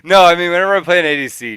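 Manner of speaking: indignant retort. Real, not acted. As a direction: fire back loudly at the teasing. Retorts to co-host's jab.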